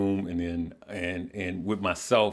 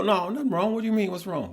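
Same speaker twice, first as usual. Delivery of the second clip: much higher, quick defensive denial; mock defensive denial; 11 semitones higher, faster than usual. Son on the phone brushing off his mom, mock high-pitched denial. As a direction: squeak out a guilty 'nothing's wrong'. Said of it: "And you go".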